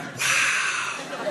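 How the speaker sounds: hushed, voiceless mock wow; almost impressed. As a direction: breathe it out like an awed cop. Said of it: "he was almost impressed".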